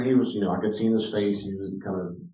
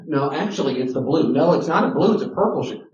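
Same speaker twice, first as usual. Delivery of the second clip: put-on bickering kids; 6 semitones higher, 5 dB louder than usual. Kids' play argument voiced.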